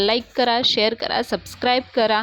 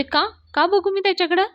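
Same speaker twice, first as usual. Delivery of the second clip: much higher, puzzled why; baffled question; 9 semitones higher than usual. Retold or imitated. Wife puzzled by the men's plea, high baffled question. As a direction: ask it in sheer confusion.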